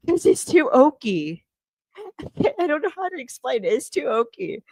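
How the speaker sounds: laughing through the words, high-pitched; helpless amusement. Real, not acted. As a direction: crack up while trying to explain. Cracking up while teasing Kane.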